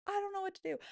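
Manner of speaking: a high, frantic "I don't know what to do"; panicked helplessness. Retold or imitated. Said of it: "I'm like".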